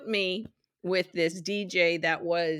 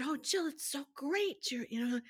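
much higher, softer gushing imitation; older friend praising her DJ job, mock gushing; 9 semitones higher, 6 dB quieter than usual. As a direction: coo it like an admiring older friend. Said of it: "she said".

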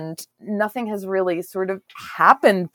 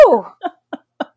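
flustered laughing, higher and louder; nervous laughter; 12 semitones higher, 8 dB louder than usual. Pretend. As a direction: fan yourself and giggle. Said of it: "the nervous laughter".

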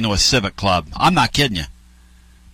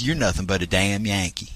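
lowered Southern voice; imitated Southern local; 5 semitones lower, 7 dB quieter than usual. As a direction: play a country man putting down a Yankee, low and soft. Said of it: "this other guy goes".

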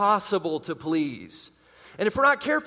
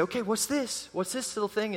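put-on parent to baby; 2 semitones higher, 6 dB quieter than usual. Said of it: "we go to our little babies and we say".

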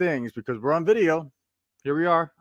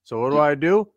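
usual speaking voice

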